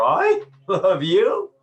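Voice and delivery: sarcastic laughing "I love you"; laughing mockery. Shown as a demonstration. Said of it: "you're conveying something very different".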